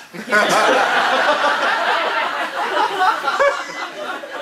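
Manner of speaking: long high laugh that rises; high burst of laughter. Real, not acted. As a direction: laugh out hard at yourself. Laughing at his own relief.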